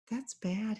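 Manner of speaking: soft, small whimper. A narrator's reading. Dog whimpers about being lost, soft and small. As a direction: whimper it softly, like a sad dog.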